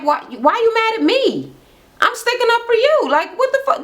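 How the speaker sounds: high-pitched exasperated appeal; retold argument, pitched well up; indignant protest. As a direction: imitate a friend baffled at being blamed. Money protests in retold quarrel.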